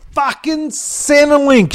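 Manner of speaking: high, drawn-out exasperated yell. A narrator's reading. Sheila left at Centrelink, yelling. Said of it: "Sheila yelled at the top of her lungs".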